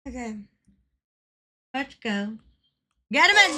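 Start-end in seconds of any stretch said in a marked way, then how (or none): 3.1-3.5 loud, high burst of hype; excited shout. Real, not acted. Streamer hyping up the game, excited shout. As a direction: shout it like a cheer.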